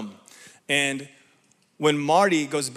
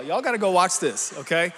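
normal talking voice

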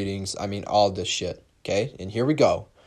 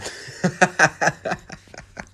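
breathy laugh; 7 semitones higher than usual. Real, not acted. Laughs at own joke.

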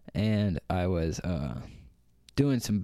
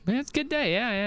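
much higher, laughing echo; high mock echo; much faster than usual. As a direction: parrot it back squeaky and amused. Echoes partner in mock voice.

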